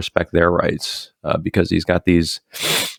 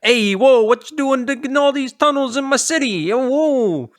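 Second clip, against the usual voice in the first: a pushy New York Italian cop. Retold or imitated. Friend mocks the cop in a New York Italian accent. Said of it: "this fucking Italian-ass cop".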